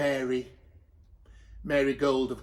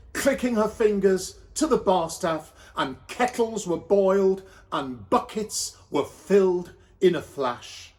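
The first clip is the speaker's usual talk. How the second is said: ordinary delivery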